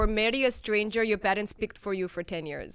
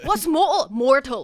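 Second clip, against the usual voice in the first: imitated Geordie accent; 3 semitones higher, 4 dB louder, slower than usual. Guest mimicking a reality show, Geordie accent. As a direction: say it like a Geordie Shore girl. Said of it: "this girl would be like".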